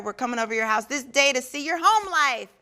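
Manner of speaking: ordinary talk, nothing marked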